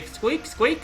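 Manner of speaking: high, squeaky rat voice; mock rat squeak. Sister mocking her brother as a rat, high and squeaky. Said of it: "Normally they just say".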